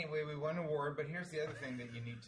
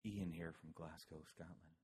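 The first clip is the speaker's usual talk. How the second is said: low, soft public-radio reading; quiet, low radio-host delivery; hushed NPR voice; 6 semitones lower, 7 dB quieter than usual. Pretend. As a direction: read the letter in a mock-solemn NPR hush. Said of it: "N P R".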